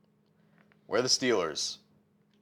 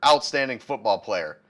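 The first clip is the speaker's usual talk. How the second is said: normal talking voice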